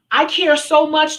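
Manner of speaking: high, loud, slow gushing; hammed-up sincere gush; mock caring. Shown as a demonstration. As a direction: overdo the caring. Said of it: "I can go from".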